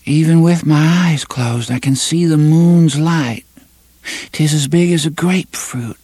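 high, far-off dreamy tone; dreaming voice. Acted. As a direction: speak as in a dream. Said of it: "A dreaming voice, faint but clear".

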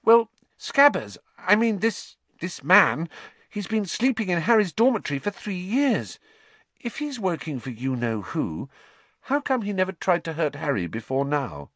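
put-on high, earnest girl's voice; careful, nervous argument; thoughtful schoolgirl; Hermione reasoning. A narrator's reading.

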